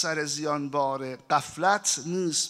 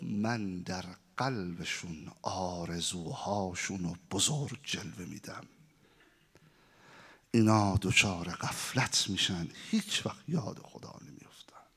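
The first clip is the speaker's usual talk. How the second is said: a demon sharing his secret plan, hushed and menacing; scheming devil; 8 semitones lower, 5 dB quieter than usual. Acted. Devil boasts his scheme to Satan. (صدای شیطانی آهسته و بم)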